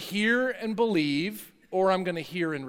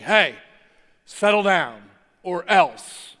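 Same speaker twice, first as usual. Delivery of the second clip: loud, slow, stern warning; loud stern father; stern dad voice; 6 dB louder, slower than usual. Retold or imitated. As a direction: bellow it like a dad at the top of the stairs. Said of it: "my dad kinda has the same voice".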